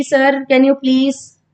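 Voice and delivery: ordinary delivery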